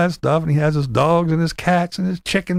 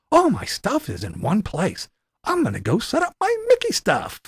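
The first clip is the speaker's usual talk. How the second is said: higher, chirpy mock cheer; fake-happy vlogger; 4 semitones higher than usual. Mocking the rival's fake cheer to camera in a higher, chirpy voice. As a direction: gush like a forced-cheerful host. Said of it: "turns the camera and goes".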